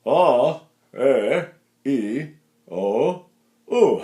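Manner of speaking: ah, eh, e, o, u sounded from down in the chest; low-placed vowels. Shown as a demonstration. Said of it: "from here down".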